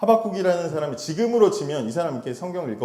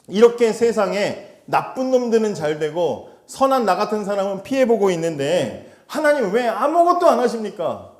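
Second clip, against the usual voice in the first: arguing with God; 7 semitones higher, 6 dB louder than usual. Acted. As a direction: press God hard for an answer. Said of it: "하나님께 따지고 있는 거예요".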